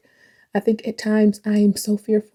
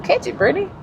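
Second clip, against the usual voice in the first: self-cheering; 9 semitones higher, faster than usual. Real, not acted. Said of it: "why am I not catching the song".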